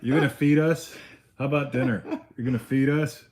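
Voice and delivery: loud, high pleading dogs; insistent high-pitched hungry-dog voice; voicing hungry dogs. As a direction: nag like dogs waiting on dinner. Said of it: "the dogs are just looking".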